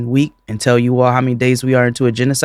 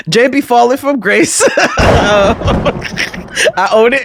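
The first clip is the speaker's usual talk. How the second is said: laughing; 8 semitones higher than usual. Real, not acted. Laughing, admits falling from grace.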